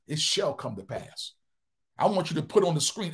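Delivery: ordinary talk, nothing marked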